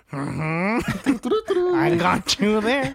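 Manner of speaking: slow, gloating sing-song with a laugh; lightly voiced smug Harry; sneaky villain. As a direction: savor catching him out. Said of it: "He's like".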